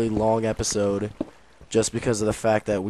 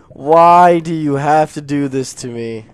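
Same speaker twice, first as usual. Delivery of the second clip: loud, slow, drawn-out lament; exasperated wail; 4 semitones higher, 10 dB louder than usual. Real, not acted. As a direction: plead with the game, pitched up. Dismayed by temptation seen.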